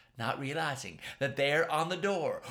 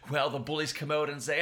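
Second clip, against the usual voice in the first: usual speaking voice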